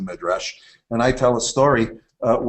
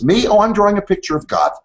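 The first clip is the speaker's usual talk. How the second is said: put-on higher and faster, a bright little kid's answer; eager child voice; 10 semitones higher, 6 dB louder than usual. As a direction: play the kid proudly explaining his drawing. Kid answers parent in story.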